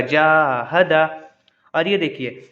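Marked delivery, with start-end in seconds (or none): 0.0-1.0 जाहदा with the जा held long and दा short; जाहदा said very slowly, long first vowel; drawn-out first syllable. Shown as a demonstration. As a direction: stretch the जा in जाहदा, then clip दा. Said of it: "मिला के पढ़ेंगे तो ऐसे ही होगा".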